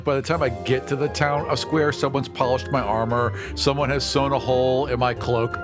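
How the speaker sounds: ordinary talk, nothing marked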